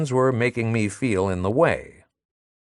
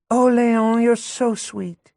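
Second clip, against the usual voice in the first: put-on higher sweet young woman with a barbed edge; Madelon, sweet and sly; 12 semitones higher than usual. Madelon cooing at Léon while jabbing the narrator, higher sweet voice. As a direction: coo at him, then slip in a dig.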